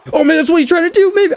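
high, fast burst of alarm; sudden realization. Real, not acted. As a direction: blurt it out as it dawns on you. Realizes opponent's plan.

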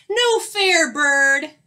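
high, loud storybook yell; loud cry of foul play; indignant yell. A narrator's reading. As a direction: yell it as an outraged complaint. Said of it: "yelled Skunk".

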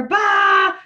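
mock scare shout; sudden shout. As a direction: jump out at the listener.

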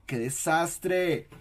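slow, high exclamation of mock dismay; a teacher's hammed-up dismay at the example. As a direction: groan it out like a playful teacher.

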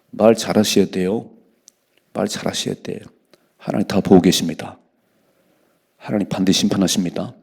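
normal talking voice

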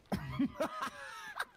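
high, wild maniacal cackle; maniacal laugh. Pretend. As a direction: cackle like a madman. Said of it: "Ich hab den Verstand verloren".

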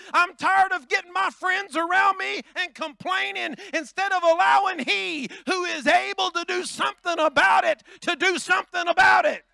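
high, loud, building sermon cry; preaching at full force. Real, not acted. Pastor preaching hard to the church, full-force loud. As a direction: whoop it up to the congregation.

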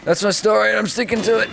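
high-pitched jokey declaration; mock insistence. Gamer blaming the mouse, high mock-defiant voice. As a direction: pipe it up high, defending a flimsy excuse. Jokey excuse for bad aim.

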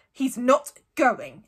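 firm, loud and slow; angry. A narrator's reading. Uncle Vernon forbidding it, angry. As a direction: say it slowly with angry force. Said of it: "looking very angry".

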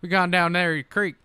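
put-on country accent. Amusing friends with outdoor tales, country accent. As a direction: spin a yarn in country accent. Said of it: "this here is a country accent".

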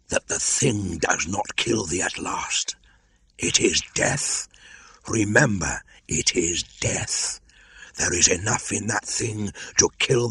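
slow, hissing warning, voice thinning to a whisper; ominous cobra. Acted. Old cobra warning Mowgli of the ankus, ominous. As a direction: curse him slowly like a dying serpent.

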